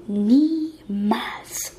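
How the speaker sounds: slow, whispery "never"; defiant refusal. A narrator's reading. Girl refuses to join the young man, whispered defiance. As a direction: refuse in a drawn-out, breathy undertone.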